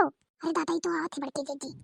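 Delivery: spotting the sister-in-law coming, hushed aside. Acted. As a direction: mutter it so she can't hear. Aside on seeing her come.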